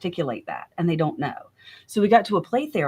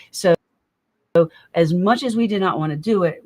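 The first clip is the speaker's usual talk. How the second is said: ordinary talk, nothing marked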